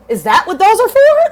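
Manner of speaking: high, loud startled question; surprised question. Retold or imitated. Narrator reacting to her friend about tarot cards, high surprised. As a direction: shoot up in pitch, amazed and loud.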